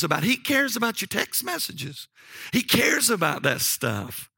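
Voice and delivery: high-pitched, emphatic sermon delivery; impassioned preaching. Acted. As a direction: drive home each repeated "cares". Preaches with rising force.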